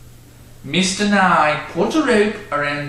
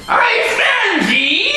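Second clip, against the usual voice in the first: put-on high, slow drunken shout; yelled; 3 dB louder, slower than usual. Drunk horse owner catching the girl on his horse, shouting. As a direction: roar it like a raging drunk. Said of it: "yelled Mister Nye".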